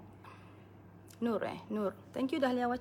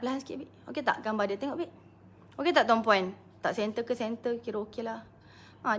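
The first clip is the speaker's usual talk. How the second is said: ordinary talk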